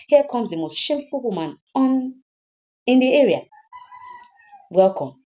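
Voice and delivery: loud, high taunting announcement; jeering husband's welcome; mocking welcome. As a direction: greet your wife with open scorn. Drunk husband taunts returning wife.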